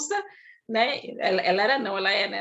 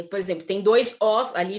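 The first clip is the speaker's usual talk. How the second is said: ordinary talk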